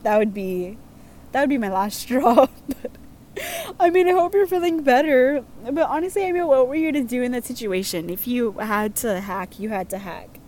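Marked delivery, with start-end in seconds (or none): none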